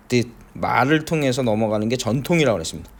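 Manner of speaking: usual speaking voice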